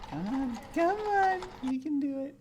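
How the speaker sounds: high-pitched coaxing urging. Real, not acted. Player urging the game character to walk over, coaxing. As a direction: egg them on in a high voice.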